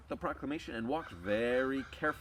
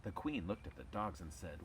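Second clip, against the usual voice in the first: ordinary delivery, nothing marked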